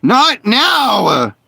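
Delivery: high, pleading outburst; frustrated cry. Real, not acted. Gamer crying out at a bad moment. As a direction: cry out in annoyance.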